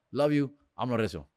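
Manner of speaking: ordinary delivery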